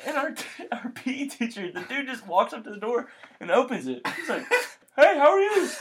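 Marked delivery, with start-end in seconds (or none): 5.0-5.7 laughing imitation. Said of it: "a deep voice".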